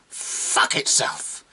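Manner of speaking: breathy, drawn-out curse, then high crow; dead monkey's retort. A narrator's reading. Dead monkey's imagined last word, whispered then high and triumphant. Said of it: "if it could have uttered a word".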